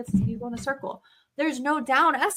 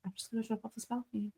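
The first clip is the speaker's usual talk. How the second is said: defeated mutter; 15 dB quieter than usual. Retold or imitated. Mom's despair retold.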